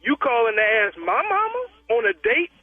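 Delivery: ordinary talk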